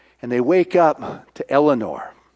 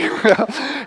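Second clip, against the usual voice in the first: laugh; 8 semitones higher than usual. Real, not acted. Laughs after party whoop.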